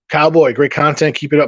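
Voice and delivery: ordinary delivery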